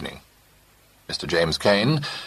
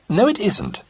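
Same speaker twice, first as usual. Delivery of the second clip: high, louder involuntary denial; blurted; 4 dB louder, slower than usual. A narrator's reading. Companion blurting denial to Jim. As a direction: let it slip out. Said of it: "unable to stop herself".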